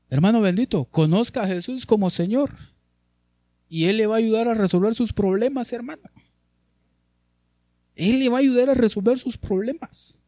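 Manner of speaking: high pleading preacher's appeal; fervent preaching. Acted. Preacher urging the congregation, high fervent appeal. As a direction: plead with the flock.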